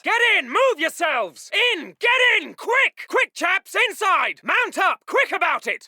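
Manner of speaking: high, pressing shout; urgent shouted order. Acted. Officer hurrying chaps inside the building, shouting urgently. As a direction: shout them into cover. Officer hurries men aboard.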